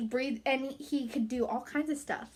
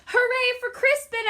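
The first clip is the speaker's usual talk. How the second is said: much higher, louder hooray; cheering shout; 10 semitones higher, 5 dB louder than usual. A narrator's reading. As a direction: cheer for the hero. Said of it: "everyone shouted".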